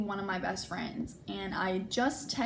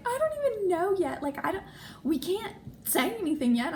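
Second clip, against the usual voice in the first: much higher, urgent pleading; frantic protest; 9 semitones higher than usual. Retold or imitated. To her boyfriend, begging him not to tell anyone yet. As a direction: protest in a rush of alarm. Arguing with Zach.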